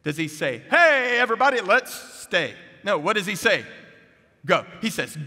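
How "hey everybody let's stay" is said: high, loud, over-bright call to a crowd; hammed-up call to everybody, pitched way up; mock hearty announcement. Lion king wrongly telling everybody to stay, mock hearty call. Mock version of the Great Commission.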